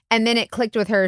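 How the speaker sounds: ordinary talk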